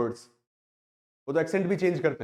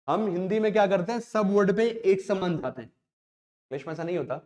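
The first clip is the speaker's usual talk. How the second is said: normal talking voice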